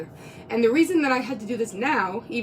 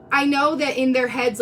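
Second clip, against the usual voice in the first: ordinary talk, nothing marked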